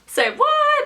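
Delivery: high-pitched "say what"; exaggerated incredulous squeal; hammed-up shock; mock disbelief. Retold reaction to being dismissed.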